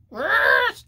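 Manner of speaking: high squealed cartoon yes; talking asshole. Body part answering the influencer's request, silly squeal. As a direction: squeeze out a very high, absurd reply. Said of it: "I don't know why her asshole talks like that".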